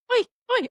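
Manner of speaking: very high little "oi" squeals; imitated massage yelp. Lady sitting on Kayla's back, high yelps. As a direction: mimic the masseuse's high noise. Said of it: "lady's just sitting there like".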